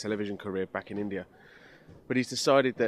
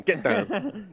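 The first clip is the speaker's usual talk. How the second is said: [name] impression; 9 semitones higher, 5 dB louder than usual. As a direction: Get down, high [name]-style yelp. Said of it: "It sounds like [name]".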